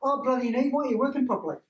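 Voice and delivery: mock-angry grumble at a knee; acted anger. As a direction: act cross with a sore knee. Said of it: "they get angry with their knee".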